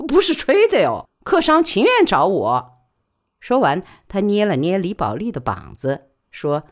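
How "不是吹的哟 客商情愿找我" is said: higher, louder earthy porter woman; loud frank porter woman gossiping; He Sao's voice. Acted. He Sao boasts.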